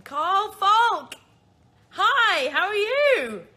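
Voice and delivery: loud, high-pitched shout of hello; excited greeting. Real, not acted. Host spots a friend joining the live, excited shouted greeting. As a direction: call out the name in delighted surprise.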